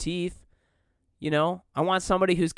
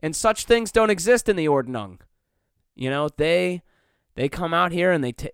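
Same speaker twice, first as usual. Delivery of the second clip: ordinary delivery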